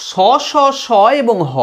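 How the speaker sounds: each স stretched into a long friction hiss; prolonged sibilants. Shown as a demonstration. Teacher lets students hear the long hissing স and হ. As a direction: hold each স so the breath rubs warm. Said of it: "অনেকক্ষণ ধরে প্রলম্বিত হয়".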